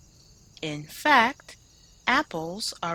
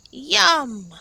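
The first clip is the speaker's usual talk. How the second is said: eager yell of yum; 5 semitones higher, 6 dB louder than usual. Acted. Pippa yelling about snack apples, a loud yum. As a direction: shout it out happily. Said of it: "yelled Pippa".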